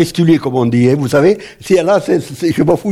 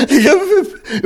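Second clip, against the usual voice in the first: higher-pitched, laughing words; 11 semitones higher, slower than usual. Real, not acted. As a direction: break up laughing at yourself mid-sentence. Laughing at own accent. (en riant de soi-même, voix plus aiguë)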